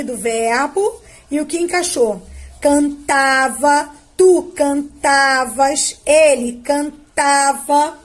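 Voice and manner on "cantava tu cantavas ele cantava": slow, each form spelled out for the class; slow conjugation drill. Shown as a demonstration. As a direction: recite the forms slowly like a teacher. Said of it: "Então, olha o acento". (conjugação lenta e pausada)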